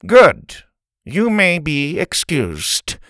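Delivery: loud, slow nasal dismissal. Acted. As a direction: dismiss her coldly. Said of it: "nasally voice".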